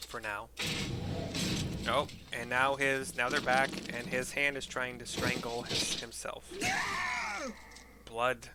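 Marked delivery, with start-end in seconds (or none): none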